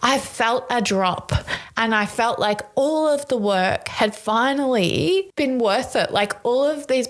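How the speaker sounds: real emotion rising through the words; emotional. Real, not acted. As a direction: voice lifting and welling up with real feeling. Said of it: "I'm gonna get really emotional".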